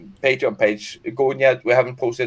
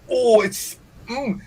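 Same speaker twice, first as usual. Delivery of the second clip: mock admiring hum; 8 semitones higher, slower than usual. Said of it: "bodies going like".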